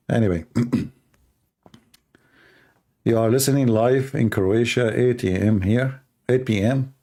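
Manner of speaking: normal talking voice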